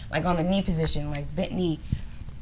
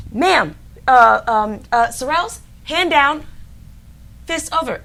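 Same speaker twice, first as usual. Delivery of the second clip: correcting instructor; 6 semitones higher, 6 dB louder, slower than usual. Retold or imitated. Instructor correcting her form.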